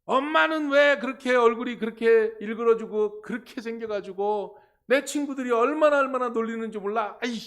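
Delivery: acted child's tantrum, loud and high; angry outburst. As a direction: yell at mom like a fed-up son. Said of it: "그러면서 화를 낸 거야". (엄마에게 화내며 소리치는 아이 연기)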